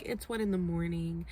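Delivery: dismissive sleepy mumble; mock tired dismissal. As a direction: wave him off, tired. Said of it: "And she's like".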